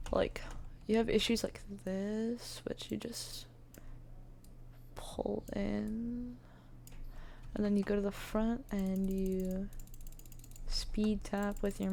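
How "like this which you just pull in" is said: whispering. Real, not acted. Muttering while sculpting.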